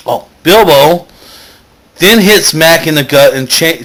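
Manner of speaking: ordinary talk, nothing marked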